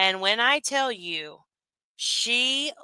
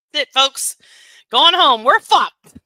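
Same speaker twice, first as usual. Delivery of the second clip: much louder, higher mock sign-off; mock announcement; 6 semitones higher, 11 dB louder than usual. Real, not acted. Streamer laughing at the clip, loud mock sign-off. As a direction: boom it out like closing a show.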